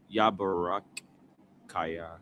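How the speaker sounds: Yabarakaya stretched slowly syllable by syllable; slow sounding out. Shown as a demonstration. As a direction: drag out ya-ba-ra-ka-ya. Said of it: "sounding out slowly".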